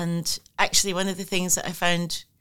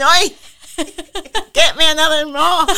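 high, loud mock yell from inside the womb; unborn baby calling out; 10 semitones higher, 10 dB louder than usual. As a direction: holler through laughter as a comic unborn baby. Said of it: "imagine myself inside the womb knocking".

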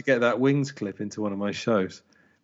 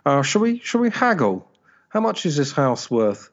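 high sing-song haggler; haggling buyer voice; 5 semitones higher than usual. Retold or imitated. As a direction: put on a much higher, lilting voice. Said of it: "I'm just dying now to say".

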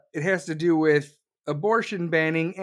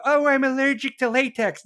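mock excuse; 6 semitones higher, 5 dB louder than usual. Mocking excuse.

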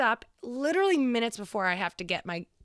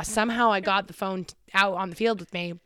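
ordinary talk, nothing marked

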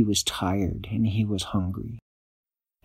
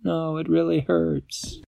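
hurt; 7 semitones higher, slower than usual. A narrator's reading. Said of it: "She said".

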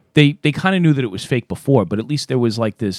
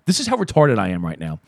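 ordinary talk

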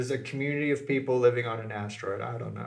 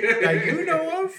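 surprised; 14 semitones higher, 5 dB louder than usual. Real, not acted. Skeptical teasing question.

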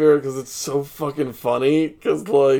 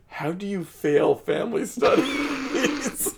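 incredulous laughing question; 8 semitones higher, 4 dB quieter, slower than usual. Retold or imitated. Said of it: "He's like".

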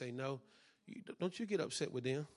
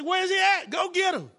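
much higher and louder, calling out; commanding shout; 14 dB louder than usual. Retold or imitated. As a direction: bark the order to bring him out. Pastor calling for the son.

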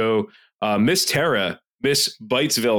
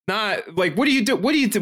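exasperated; 8 semitones higher than usual. Real, not acted. Exasperated rant about serial reviewer.

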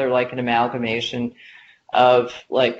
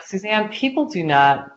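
put-on Boston accent; 6 semitones higher than usual. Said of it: "you have to say this with a very heavy Boston accent".

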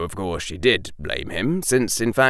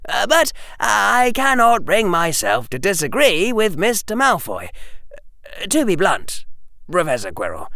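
put-on high-pitched, formal boyish speech; blunt young Harry; 12 semitones higher, 3 dB louder than usual. Harry telling Professor Quirrell about Hermione, blunt. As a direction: deliver a frank verdict, high and emphatic.

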